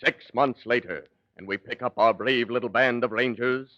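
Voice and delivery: usual speaking voice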